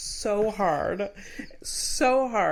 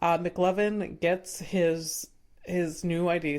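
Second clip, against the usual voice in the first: ordinary talk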